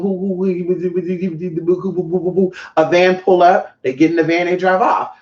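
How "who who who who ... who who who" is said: quick burbling nonsense babble; imitated group chatter. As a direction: mimic people chattering among themselves. They entertaining themselves.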